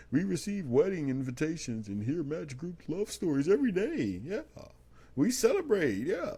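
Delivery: high, singsong corporate gushing; hammed-up spokesperson, pitch swooping way up; mock spokesperson. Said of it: "this is how they sound in my head".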